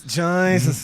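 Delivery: slow, drawn-out Dublin "Jesus" as "Chaizus". Shown as a demonstration. Showing his new Dublin habit to his co-host, slow Dublin accent. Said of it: "I do say Jesus a lot more".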